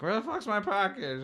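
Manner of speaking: high-pitched whine of a customer; mock irate caller. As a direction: imitate a complaining caller way up high. Voicing angry call-center customers.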